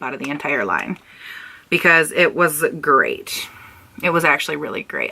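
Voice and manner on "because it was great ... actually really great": low, downbeat praise; reluctant praise sinking in pitch; sounding sad. Real, not acted. Vlogger admitting one product was good, sounding oddly sad. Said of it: "I say that like I'm so sad".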